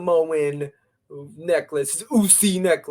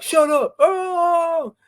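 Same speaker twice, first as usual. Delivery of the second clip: high, loud mock yell; imitated outburst; 14 semitones higher, 6 dB louder, much slower than usual. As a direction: act out a wrestler blowing up, voice leaping up. Acts out Jay's outburst in retelling.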